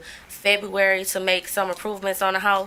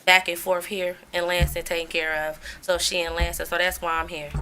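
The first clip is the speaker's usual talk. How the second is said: ordinary talk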